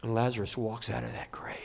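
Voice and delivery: whispered in awe; preacher whispering the miracle to his congregation; hushed whisper. A narrator's reading. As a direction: breathe it, barely a sound. Awed telling of the miracle.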